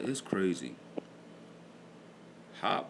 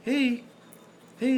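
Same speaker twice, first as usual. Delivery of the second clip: put-on high, sing-song little kid greeting; childlike hey; much slower than usual. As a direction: model a toddler's hello.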